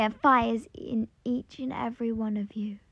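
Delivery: soft, falling away toward a whisper; hushed close. Acted. Fire closes its speech to everyone, hushed. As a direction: let it die down almost to a whisper.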